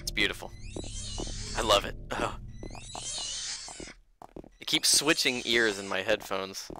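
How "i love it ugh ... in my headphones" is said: usual speaking voice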